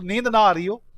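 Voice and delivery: usual speaking voice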